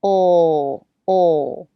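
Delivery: "Ol" dark L with tongue pressed back; isolated dark L. Shown as a demonstration. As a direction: voice the dark L "ol" alone. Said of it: "back of the tongue presses back".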